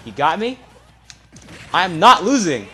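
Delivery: defiant shout. Real, not acted. Player defying the opponent, loud high shout. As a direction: roar your refusal to lose. Defiant during final stock.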